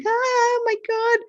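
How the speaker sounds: thrilled and swamped at once; overwhelmed. Retold or imitated.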